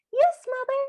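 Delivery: high, sweet yes-mother reply; meek Cinderella. Retold or imitated. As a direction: chirp it up like an obedient girl. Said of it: "So Cinderella goes".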